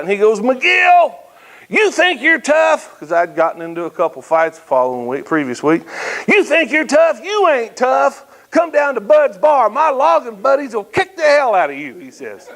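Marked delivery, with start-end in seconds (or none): 0.4-3.0 high, slow taunting yell from the coach; coach freaking out. Retold or imitated. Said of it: "he just freaks out". 6.3-11.9 coach freaking out. Retold or imitated. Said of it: "he just freaks out".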